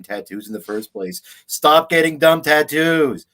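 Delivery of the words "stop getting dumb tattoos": louder and slower, a firm scolding command; exasperated outburst. Real, not acted. Ranting at people getting bad tattoos, loud slow scolding.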